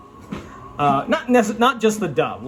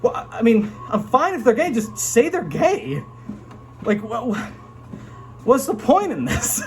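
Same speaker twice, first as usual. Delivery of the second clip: exasperated; 6 semitones higher than usual. Retold or imitated. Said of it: "And you're like".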